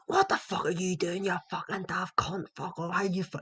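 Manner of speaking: high-pitched heckle at a player; mock angry shouting. Fan berating his own players at a football game, mock angry shouting. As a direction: re-enact a sweary terrace rant without full volume. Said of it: "even shouting at our own players".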